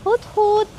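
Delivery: high mock owl hoot. Hooting at husband in the store, mock owl call.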